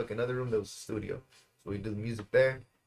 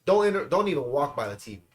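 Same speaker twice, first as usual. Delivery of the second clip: ordinary talk, nothing marked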